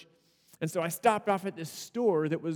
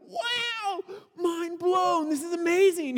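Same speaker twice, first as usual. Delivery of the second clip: high, awestruck wow; amazed; 10 semitones higher, slower than usual. Retold or imitated. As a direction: gasp in amazement. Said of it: "We're like".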